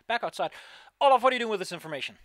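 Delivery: loud, fast, high call; excited shout. Acted. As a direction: yell it out in a rush. GM calls out in character.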